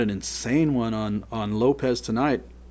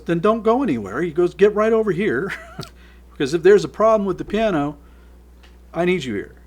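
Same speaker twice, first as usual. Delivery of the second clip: retold order; 7 semitones higher, 7 dB louder than usual. Retold order to stay by piano.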